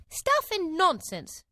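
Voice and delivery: high, slow, loud scoff; loud retort. A narrator's reading. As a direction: say it loudly, defiantly. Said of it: "said Alice loudly".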